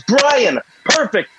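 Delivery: high, breezy mishearing of the name; mock grateful stranger. Stranger cheerfully getting the driver's name wrong, high voice. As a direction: chirp it happily, sure he's right.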